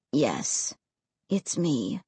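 low, soft ghostly voice. A narrator's reading. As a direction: speak it softly and low. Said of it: "he said softly".

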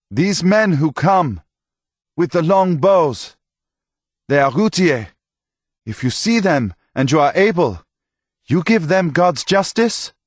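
stern priest. Acted. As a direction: lay the duty on him gravely. Priest charges Tomas.

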